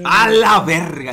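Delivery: loud, drawn-out echo of shock; exaggerated, stretched exclamation; mock astonishment. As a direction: repeat it slowly, hammed-up amazement. Echoing the clip in shock.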